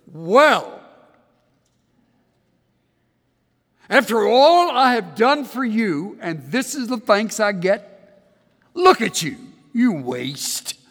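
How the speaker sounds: mock scolding. A God who scolds the weary prophet, loud and exasperated. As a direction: lay into him like an ungrateful servant. Harsh rebuke God never gave.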